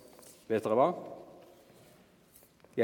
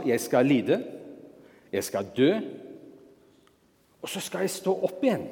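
with certainty. Shown as a demonstration. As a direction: say it like a promise. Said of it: "Med sikkerhet". (med full sikkerhet)

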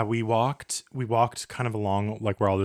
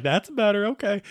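high pleased; 11 semitones higher than usual. Retold or imitated. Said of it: "I'm like".

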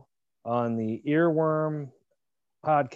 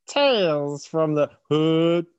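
drawn-out mimicry, higher and punchy; imitating the clip; 4 semitones higher, 4 dB louder, slower than usual. Host explains what the clip is saying, drawn-out imitation. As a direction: stretch each word in a mock title call. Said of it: "it's saying".

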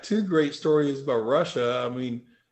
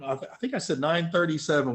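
ordinary talk, nothing marked

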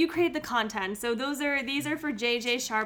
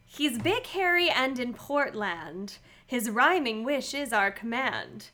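limerick reading; 4 semitones higher, 7 dB louder, slower than usual. Acted. Reads limerick aloud.

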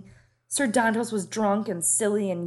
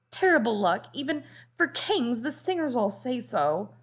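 desperate pleading; 6 semitones higher than usual. Acted. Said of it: "the words tumbled out desperately".

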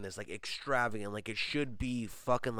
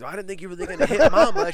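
mock mama voice; 7 semitones higher, 11 dB louder than usual.

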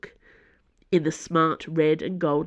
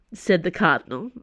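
ordinary delivery

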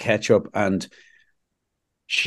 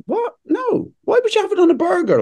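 incredulous outrage. Real, not acted. [name] answering about burgers, shocked and high. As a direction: pitch leaps up in disbelief.

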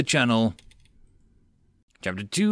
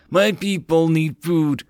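frustrated growl; 6 semitones higher, 3 dB louder, slower than usual. A narrator's reading. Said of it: "growled in frustration".

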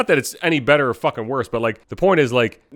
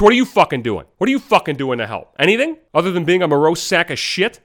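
real angry tirade, raised and louder; worked-up rant; 6 semitones higher, 4 dB louder than usual. Real, not acted. Podcaster challenging those who call others selfish, worked up and angry. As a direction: fire accusing questions, getting heated. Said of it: "I'm getting fucking worked up".

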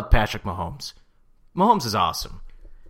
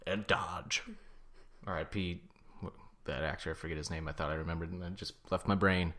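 much softer, low trailing aside; muttering; 7 semitones lower, 14 dB quieter than usual. Real, not acted. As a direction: mumble it to yourself. Mumbled aside.

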